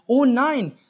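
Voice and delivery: shocked as the smoke appears; sudden fright at the burning buns; alarmed oh no. Acted. Remembers buns burning in oven.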